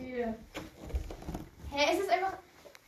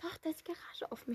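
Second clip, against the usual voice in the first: heimliches Flüstern, kaum Stimme; tuschelnd, damit draußen keiner etwas hört; flüsternd; 4 semitones higher, 15 dB quieter than usual. Real, not acted. Fürchtet, Nachbarn hören mit. (Flüstern, weil die Garage offen ist)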